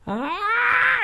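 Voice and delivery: very high, sustained mock scream; imitated kid scream. Dad imitating his son charging at him, high mock scream. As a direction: squeal like a kid on a run. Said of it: "he'll just start screaming".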